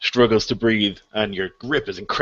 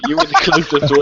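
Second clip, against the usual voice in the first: loud, high words through laughter; laughing; 7 semitones higher, 8 dB louder than usual. Real, not acted. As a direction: crack up while explaining to the player. Game master laughing at player's bad luck.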